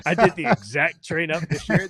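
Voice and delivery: high, loud laughing disbelief. Real, not acted. Runner laughing at his own unchanged training, high and loud. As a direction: laugh through the admission.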